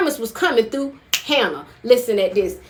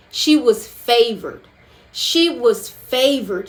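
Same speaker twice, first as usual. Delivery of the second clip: slow emphasis; slower than usual. Real, not acted. Preacher savouring the point.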